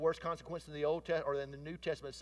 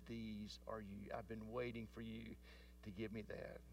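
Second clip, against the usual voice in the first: tender father; 4 semitones lower, 9 dB quieter than usual. Retold or imitated. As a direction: hand her the real pearls gently. Father gives real pearls tenderly.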